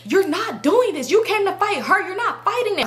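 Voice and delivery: high, emphatic mimicked objection; acted friend's protest. Friend limits who Simone fights, high insistence.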